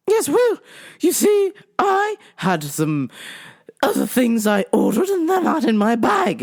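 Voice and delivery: high, fussy English complaint; mock-English customer, high-pitched; phony English accent. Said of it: "phony English accent".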